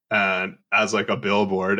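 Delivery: usual speaking voice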